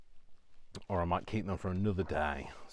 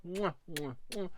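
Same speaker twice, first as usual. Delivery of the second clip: blown kisses; 9 semitones higher than usual. Real, not acted. Blowing kisses goodbye.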